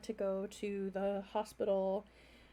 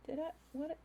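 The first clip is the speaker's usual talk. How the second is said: ordinary talk, nothing marked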